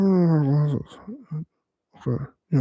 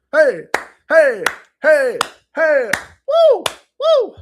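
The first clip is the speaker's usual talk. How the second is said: hey calls climbing much higher, loud; rising hype calls; 10 semitones higher, 8 dB louder, slower than usual. Shown as a demonstration. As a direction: pump up the crowd, rising each time. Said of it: "Or you can go upwards".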